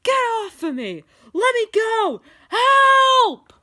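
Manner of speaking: high, loud scream building to a shriek. A narrator's reading. Steve dragged by zombies, screaming for help. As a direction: scream to be let go. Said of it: "while he was screaming".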